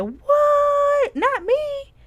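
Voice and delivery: high, loud incredulous squeal; shocked disbelief. Retold or imitated. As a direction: retell the shock of being called bitter. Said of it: "And I was like".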